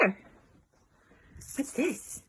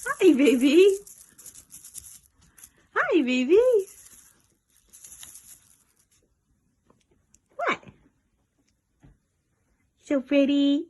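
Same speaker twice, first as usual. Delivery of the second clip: high cooing pet talk; 8 semitones higher than usual. Real, not acted. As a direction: gush over a pretty pet.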